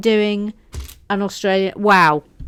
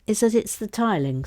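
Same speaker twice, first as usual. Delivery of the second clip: usual speaking voice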